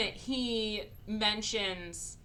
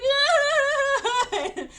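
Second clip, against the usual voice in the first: much higher and louder laughing shriek; mock scream while laughing; 12 dB louder than usual. Sister tripping onto the pool concrete, a laughing shriek. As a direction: shriek as she falls face first. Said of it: "She's like".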